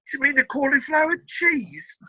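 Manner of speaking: high-pitched imitation of a puzzled chef; mock-incredulous high voice. Chef querying the fancy dish name.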